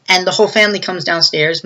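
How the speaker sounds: ordinary delivery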